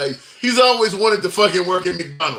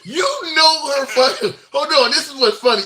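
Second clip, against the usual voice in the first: laughing; 6 semitones higher than usual. Real, not acted. Host laughing at guest's deliberate name mistake. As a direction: laugh while calling him out.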